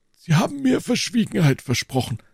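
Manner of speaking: put-on high-pitched old man's voice. A narrator's reading. Old man reminding D'Artagnan of his promise, high-pitched. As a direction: raise the voice into an old man's plea. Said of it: "sagte der Greis".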